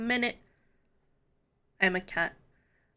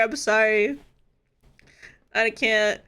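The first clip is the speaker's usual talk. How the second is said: ordinary talk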